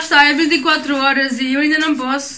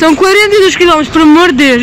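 shocked; 4 semitones higher, 8 dB louder, faster than usual. Real, not acted. Shocked at marathon distance.

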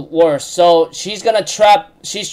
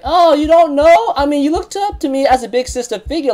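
teasing big sister; 8 semitones higher than usual. Retold or imitated. Elizabeth teasing Merlin.

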